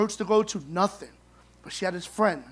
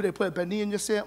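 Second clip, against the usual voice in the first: ordinary delivery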